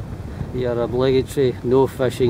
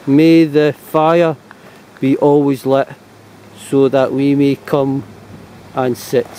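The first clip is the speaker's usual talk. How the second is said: slow, louder verse recital; reciting a verse; 4 dB louder, slower than usual. Shown as a demonstration. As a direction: recite it slowly like a poem. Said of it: "saying here".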